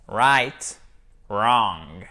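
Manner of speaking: "Right, wrong" each begun on a clean R; English R demo. Shown as a demonstration. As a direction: model "Right, wrong" one at a time, no V. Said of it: "Ça commence par le R". (« Right, wrong » avec R anglais initial)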